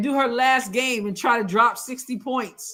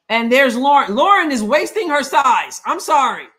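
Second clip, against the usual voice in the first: exasperated shout; 2 semitones higher, 7 dB louder than usual. Real, not acted. Frustrated fan yelling at game.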